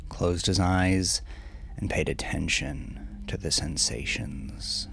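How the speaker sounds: ordinary delivery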